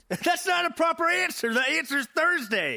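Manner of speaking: high-pitched indignant ranting; worked-up, high comic exasperation; exasperated outburst. Real, not acted. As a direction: exclaim it in rising outrage. Outraged over a wrong answer.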